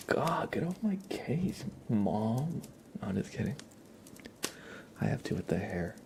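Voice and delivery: ordinary delivery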